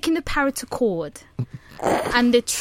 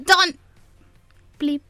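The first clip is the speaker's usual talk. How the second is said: parrot voice; 5 semitones higher, slower than usual. Acted. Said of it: "do it in the voice of a parrot".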